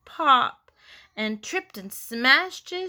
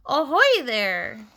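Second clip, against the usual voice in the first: drawn-out hail from the bow; pirate shout; 3 semitones higher, 4 dB louder, slower than usual. A narrator's reading. Froggy atop gigantic pumpkin like a pirate, hearty shout. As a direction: hail them like a pirate lookout. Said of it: "like a pirate on the bow of a ship and shouted".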